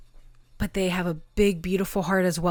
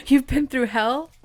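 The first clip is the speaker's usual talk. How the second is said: higher and louder, laughing through it; 7 semitones higher, 5 dB louder than usual. Real, not acted. Tarot reader laughs as she repeats it to Pile Three. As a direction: laugh it out after a giggle.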